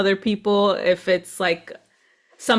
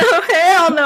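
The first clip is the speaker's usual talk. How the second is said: higher, laughing cry of refusal; mock alarmed, laughing; 6 semitones higher than usual. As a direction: play the panicked patient while cracking up. Playing alarmed patient, laughing.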